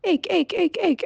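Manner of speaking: high, sharp frog croaks; croaking frogs. A narrator's reading. Frogs crying at the peasant from the pond, high croaks. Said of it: "the frogs still cried".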